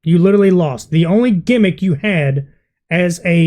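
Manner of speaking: ordinary delivery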